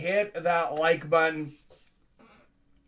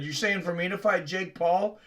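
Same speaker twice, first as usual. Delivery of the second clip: ordinary talk, nothing marked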